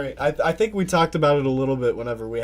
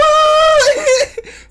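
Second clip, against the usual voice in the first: high, loud burst of laughter; real shrieking laugh; 14 dB louder than usual. Real, not acted. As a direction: howl with laughter. Cracking up at the story.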